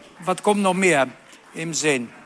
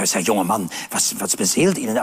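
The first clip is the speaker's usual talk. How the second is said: much lower, a gruff shop clerk; a lower, mock-stern shop assistant; low salesman voice; 8 semitones lower than usual. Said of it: "Dann sagt der Verkäufer".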